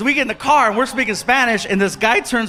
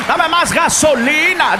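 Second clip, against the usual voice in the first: mock high finish; 6 semitones higher, 4 dB louder, slower than usual. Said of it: "like there's a time limit".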